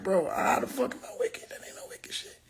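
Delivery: unvoiced whisper, quick; whispered aside. Real, not acted. Reacting to being called wicked, whispered. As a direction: hush it under your breath.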